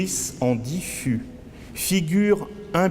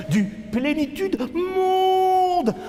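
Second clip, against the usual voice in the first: high, slow drawn-out cry; theatrical wailing chant, very high; wailed refrain; slower than usual. Acted. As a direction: soar up and hold the last word. Refrain cried out in poem.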